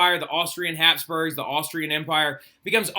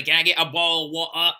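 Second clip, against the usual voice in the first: lightly imitated British accent, dropped t's; mock British accent; 4 dB louder than usual. Teacher echoing a British meme to the class, mock British accent. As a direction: echo a British-accent joke, swallowing the t's. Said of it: "British people be like".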